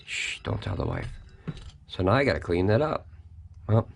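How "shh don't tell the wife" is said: low, soft mock secret; conspiratorial hush. Reviewer jokingly keeping extra cheese from his wife, conspiratorial hush. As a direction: shush them, then drop it low as if sharing a secret.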